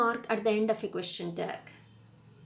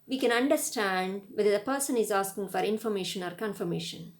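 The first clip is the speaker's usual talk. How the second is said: ordinary talk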